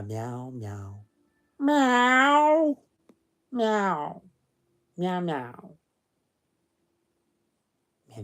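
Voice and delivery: cat meows in place of words; playful meowing. Pretend. Silly reader meowing instead of telling the story, playful meows. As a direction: meow through it, varying pitch and volume.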